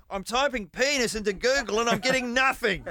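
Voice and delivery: high, far off imitation of the producer; a hammed-up high voice for the producer; mock producer. Host mimicking producer Nikki at the computer, high. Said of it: "Nikki, is over at the computer going".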